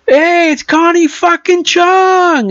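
high, drawn-out mock call; imitating Jason Mewes. Actor calling to Courtney Cox in line, imitated high holler. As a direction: stretch it out way up high, like a heckler. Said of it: "looks at Courtney Cox and goes".

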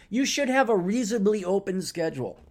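ordinary talk, nothing marked

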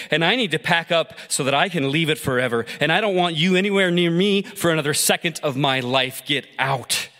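a wife walking out on her husband, heated; angry wife. Acted. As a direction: tell him off for good. Wife leaving husband in anger.

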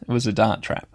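normal talking voice

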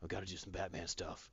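low, soft, growly Batman impression; gruff Batman voice. Podcaster voicing Batman warning a wizard, low and soft. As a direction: play it like a brooding Batman telling the wizard to stay back.

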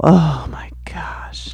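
slow, breathed-out whisper; exasperated sigh on the words, half speed; real hushed exasperation. Real, not acted. Exasperated as new complaint begins.

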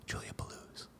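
whispered name; breathy whisper. Acted. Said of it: "live performance of the sound clip".

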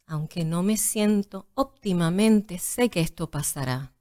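yoga teacher voice. Shown as a demonstration. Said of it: "en tu voz de maestra de yoga".

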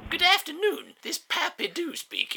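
put-on a creakier old-timer picks up; older man on phone. Pappy Doo answering Howard's call, high old voice.

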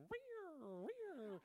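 high cat screech; cat yowl. Retold or imitated. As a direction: yowl like a cat tied by the tail. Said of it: "we're like two cats where their tails are tied together".

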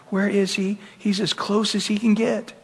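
usual speaking voice

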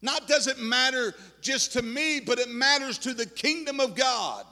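ordinary delivery

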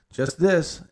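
outdoor voice. Retold or imitated. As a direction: say it too loud, as if outdoors. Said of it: "in her outdoor voice".

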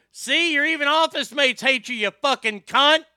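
high, loud parting insult; angry yell. Retold or imitated. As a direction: hurl it at her at full voice. Retold parting insult before slamming door.

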